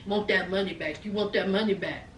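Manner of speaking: low, quiet mocking echo; softly muttered mock demand; muttered mimicry. Mimics the demand under her breath.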